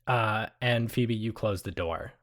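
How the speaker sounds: ordinary delivery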